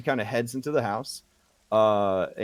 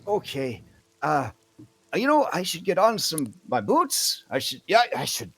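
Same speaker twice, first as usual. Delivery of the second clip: put-on excited old woman; 4 semitones higher than usual. Old woman thrilled by secret invitation.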